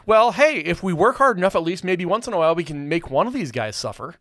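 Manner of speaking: high, loud upbeat rallying voice, fading down; pep-talk energy that settles by the end; mock rallying cry. Critic voicing the better moral as an upbeat rally, high and loud. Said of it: "the moral ... would be".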